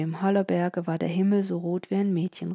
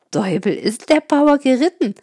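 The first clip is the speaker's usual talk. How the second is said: high and loud in astonishment; amazed exclamation; 6 semitones higher, 8 dB louder than usual. A narrator's reading. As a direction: marvel out loud. Amazed about the fast ride.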